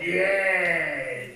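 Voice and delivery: ordinary talk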